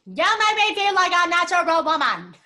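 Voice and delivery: loud puppet-jaw speech with laugh. Shown as a demonstration. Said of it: "jaw is almost like a puppet".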